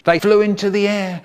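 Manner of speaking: plain reading voice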